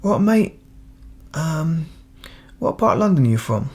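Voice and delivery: Cockney greeting that starts high; Cockney accent. Acted. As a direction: imitate a Cockney asking a fellow Londoner. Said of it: "he's speaking Cockney".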